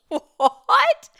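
a shocked cry of disbelief; stunned what. Real, not acted. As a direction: blurt it out in disbelief. Stunned by the definition.

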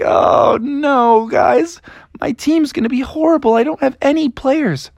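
high-pitched comic lament; hammed-up despair, pitched high; dismayed wailing. Froggy laments his team.

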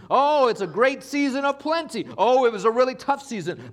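high, loud preacher's call; full-force preaching. Acted. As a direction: preach it out to the congregation.

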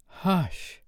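high, drawn-out shush; hushing. Acted. Wendy quieting Toodles, hushing. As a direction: silence an excited child.

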